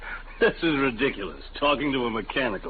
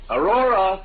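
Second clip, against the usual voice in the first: calling out; 7 semitones higher, 4 dB louder, slower than usual. Acted. Husband calls wife, hearing about boarder.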